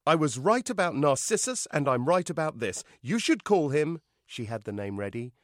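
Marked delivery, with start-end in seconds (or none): 0.1-4.0 loud, raised, pouty argument; higher, emphatic sulky protest; pouting insistence. A narrator's reading. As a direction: insist petulantly that you know best. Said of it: "Echo pouted".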